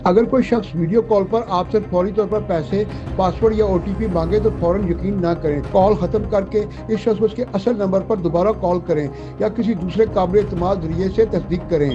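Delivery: usual speaking voice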